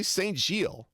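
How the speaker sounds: Saint Gilles with English "saint", T sounded, then "zheel"; English-style pronunciation. Shown as a demonstration. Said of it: "Just do it English".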